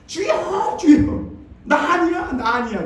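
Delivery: high, loud frightened cry for mercy; hammed-up terrified yell, pitched high; panicked scream. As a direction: shriek it out in a comic panic, begging. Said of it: "소리를 빡 질렀어요".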